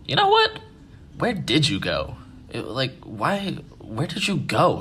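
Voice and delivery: high-pitched retold outburst, jumping up at the start; mad mom. Mom to son back by the fire, imitated mad questioning. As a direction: imitate a mother demanding answers. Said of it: "gets mad again, and goes".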